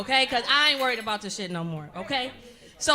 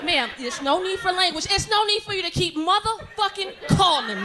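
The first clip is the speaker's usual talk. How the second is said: telling off collector; 4 semitones higher than usual. Acted. Debtor cursing out a bill collector, high and forceful. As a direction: curse out the caller with rising force.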